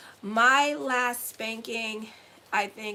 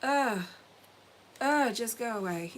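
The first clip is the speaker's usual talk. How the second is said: fed-up groan, then dismissive; mildly disgusted brush-off; exasperated. Retold or imitated. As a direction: wave someone away with a weary ugh. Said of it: "And I'm like".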